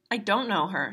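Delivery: plain reading voice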